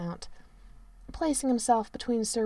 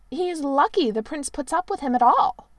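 sniffy; 6 semitones higher, 6 dB louder than usual. A narrator's reading. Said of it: "Sir Rhys sniffed".

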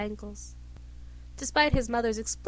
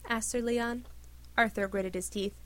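usual speaking voice

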